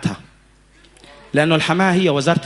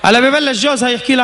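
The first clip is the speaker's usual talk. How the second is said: normal talking voice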